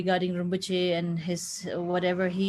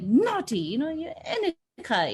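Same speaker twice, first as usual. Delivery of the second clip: mock scolding; 7 semitones higher than usual. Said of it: "the way he used to scold".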